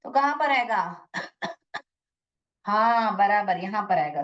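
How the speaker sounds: ordinary talk, nothing marked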